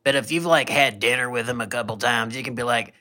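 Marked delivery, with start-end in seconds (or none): none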